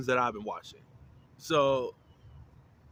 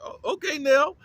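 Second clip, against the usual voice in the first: high, rising oh-okay, louder on the name; amused surprise; 13 semitones higher, 7 dB louder than usual. Retold or imitated. Viewer reacting to friends arguing, amused high surprise. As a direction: react high and impressed, ending on a loud lift.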